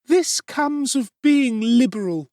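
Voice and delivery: high, loud fussy woman lamenting; a matron wringing her hands; pious sigh. A narrator's reading. Mrs Sowerberry lamenting to the ceiling, high pious sigh.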